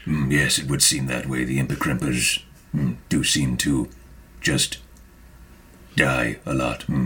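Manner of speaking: low, musing character voice; low, hesitant sage with thoughtful hums; grave Maka. Acted. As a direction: ponder solemnly in a low register. Plays Maka, grave figure.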